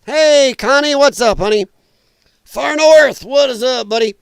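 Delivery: loud, high, cheery hello; excited greeting. Real, not acted. Streamer greeting regulars in the chat, loud and cheery.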